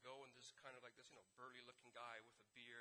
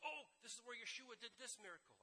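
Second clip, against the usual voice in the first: high, eager exclamation; imitating an enthusiastic guide, pitched up; excited guide; 8 semitones higher than usual. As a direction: show off the site with delight. Acting excited guide at miracle site.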